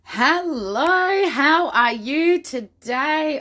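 normal talking voice

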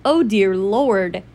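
slow, long-drawn exclamation; retold mock dismay. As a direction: let each word drag out in disbelief. Retelling reaction to loud game gore.

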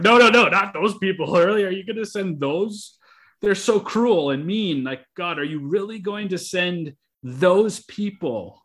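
high, loud, pleading protest to God; pleading prophet. Retold or imitated. Preacher acting out Habakkuk protesting to God, high incredulous plea. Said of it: "He's like".

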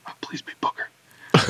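pleading whisper. Real, not acted. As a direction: whisper a desperate plea. Whispered plea before tasting.

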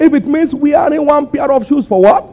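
high rising prompt to the crowd; call and response. Acted. Preacher prompting the congregation to finish, high and rising. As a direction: pitch it high and leave the answer open. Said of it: "call and response".

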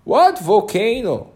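high, loud, slow baffled question; a confused king calling back; puzzled king. A narrator's reading. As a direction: ask it bewildered, looking around. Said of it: "said the king".

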